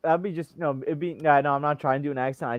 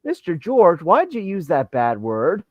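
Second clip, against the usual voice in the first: put-on louder, drawn-out child's question; imitated school kid; 4 semitones higher, 5 dB louder, slower than usual. Kid who found teacher's channel, imitated in a loud complaint. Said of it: "then they're like".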